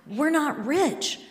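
high, loud, frantic protest; husband's rapid-fire pleading. Retold or imitated. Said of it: "starts talking ninety miles an hour".

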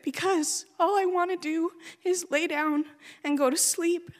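high, strained grieving voice; pushing words out through grief; real grief recalling the weeks after; distraught. Real, not acted. Despair after mother's death.

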